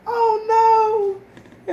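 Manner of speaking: high, loud cry of dismay. Retold or imitated. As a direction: wail it like panic before going on. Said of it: "I was like".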